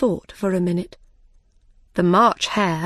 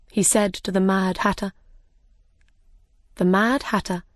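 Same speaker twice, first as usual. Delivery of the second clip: ordinary delivery, nothing marked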